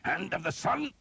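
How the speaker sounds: high, gritted-teeth snarl. A narrator's reading. Priest striking an enemy, a high snarl. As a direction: spit it out as he breaks a nose. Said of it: "Father Hobb snarled".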